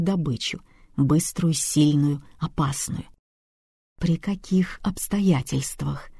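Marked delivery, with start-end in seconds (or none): 4.0-6.1 slow, soft, whispered coaxing question; insinuating whisper. Acted. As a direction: draw it out in a sly whisper. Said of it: "вкратчиво спросил он".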